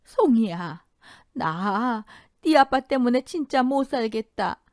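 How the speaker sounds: a grandmother's slow, shaky lament; trembling voice. A narrator's reading. Grandmother telling Songi about her father, trembling. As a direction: let the voice tremble with worry, slowly. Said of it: "떨리는 목소리로 말씀하셨습니다".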